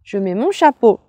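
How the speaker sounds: put-on high, loud, bouncy kids' host; bright squeaky sing-song welcome.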